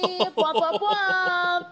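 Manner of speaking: high, loud playful fanfare noises; triumphant bwomp noises. Real, not acted. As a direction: sing out a goofy victory fanfare. Player celebrates nat twenty.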